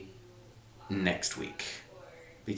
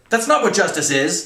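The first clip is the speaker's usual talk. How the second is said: loud, high scornful retort; jeering; 10 semitones higher, 10 dB louder than usual. A narrator's reading. Colonel dismissing cadet's idea of justice, jeering. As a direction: jeer it at full volume. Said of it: "the colonel jeered".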